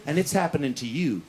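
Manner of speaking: low, drawn-in, self-important gloating; low, portentous self-congratulation; smug inner boast. Acted. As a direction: savour the triumph in a deep voice.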